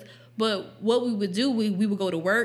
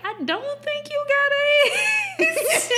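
very high-pitched nurse imitation breaking into laughter; amused nurse; 4 dB louder than usual. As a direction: squeal it as the nurse, barely holding back laughs. Acting out nurse reassuring her, amused.

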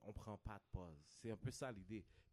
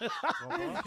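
laughing; 14 dB louder, much slower than usual. Real, not acted. Right after co-host laughs.

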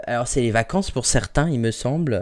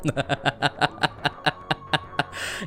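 breathy laugh; 4 dB quieter than usual. Real, not acted. Host laughs after joke.

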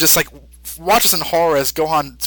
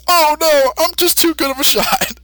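Mister Satan; 11 semitones higher than usual. Retold or imitated. Mister Satan boasting about the shot, high and hammy. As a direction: ham up the bragging shock. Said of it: "and says".

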